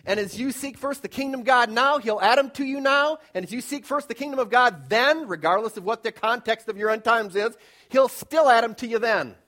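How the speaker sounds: loud, rising preacher cadence; preaching at full force. Acted. Pastor preaching to his church, loud building cadence. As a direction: drive the point home to the congregation.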